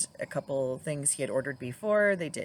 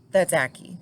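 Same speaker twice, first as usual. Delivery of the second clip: insistent; 2 semitones higher, 10 dB louder than usual. Retold or imitated. Husband quoted insisting.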